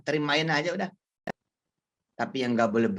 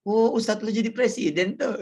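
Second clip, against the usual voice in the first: high teasing gossip voice; mock gossip; 9 semitones higher than usual. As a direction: sneer like a gossiping neighbour.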